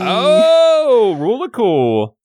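high, loud drawn-out "oh"; excited cheer. Real, not acted. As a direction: crow with delight at the lucky roll. Good roll for risky stunt.

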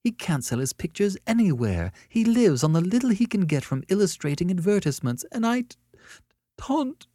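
high wail breaking off; tearful outburst. A narrator's reading. Annette confesses taunting a struggling illustrator, close to sobbing.

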